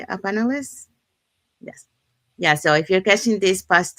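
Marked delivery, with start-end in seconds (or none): none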